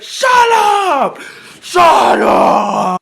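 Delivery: very high, very loud drawn-out scream; mom's yelling. Retold or imitated. Mom in the car silencing her kid, furious yelling. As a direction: scream it right in the kid's face. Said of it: "stop doing all that yelling in my face".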